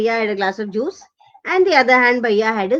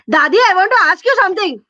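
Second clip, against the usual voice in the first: fast, high shouted question; shouting in ear; 6 semitones higher, 4 dB louder, much faster than usual. Acted. Boy yelling in dozing grandmother's ear, high fast shout. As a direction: blurt it out high and quick. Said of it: "screaming in their ears".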